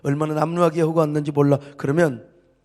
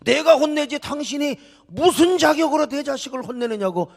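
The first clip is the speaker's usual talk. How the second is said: high-pitched indignant protest; acted parent's outraged objection; offended parent; 7 semitones higher than usual. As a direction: confront a stranger for scolding your child. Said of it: "아버지가 얼마나 기분 나빠요".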